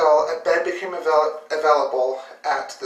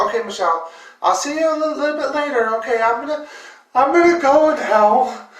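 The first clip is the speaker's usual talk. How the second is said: telling her cousin goodbye, higher and louder; nervous placating; 8 semitones higher, 5 dB louder than usual. Retold or imitated. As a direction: ease out of the room politely. Leaving gently, humoring her.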